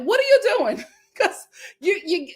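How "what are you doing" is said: incredulous. Retold or imitated. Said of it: "And it's like".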